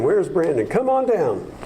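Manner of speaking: ordinary delivery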